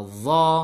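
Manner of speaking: "dho" with a rounded, held o; O vowel. Shown as a demonstration. Teacher lists heavy letters, "dho" with O vowel. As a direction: round and hold "dho" heavily. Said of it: "pakai vokal O".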